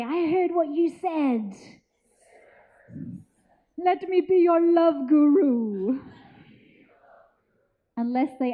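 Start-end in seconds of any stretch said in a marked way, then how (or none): 0.0-6.0 slow, drawn-out guru voice; love guru voice. Retold or imitated. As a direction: play a smooth love guru. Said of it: "and say".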